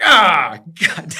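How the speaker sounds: loud high disgusted "Ah, God"; disgusted groan. Retold or imitated. Boss disgusted as the new guy throws up, loud groan. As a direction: groan it like you're sick of him. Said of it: "He's like".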